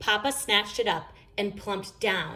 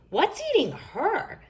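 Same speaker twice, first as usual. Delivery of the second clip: a hint of puzzled Brother; 11 semitones higher than usual. A narrator's reading. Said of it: "said Brother".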